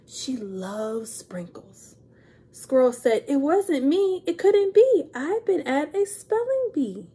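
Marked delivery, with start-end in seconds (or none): 0.1-2.0 slow hushed whisper; whispered secret. Acted. Gus whispering his guess to Pete. Gus voiced in read-aloud story. 3.3-7.1 higher, louder squeaky denial; squirrel's protest. Acted. Squirrel insisting to Gus she didn't do it.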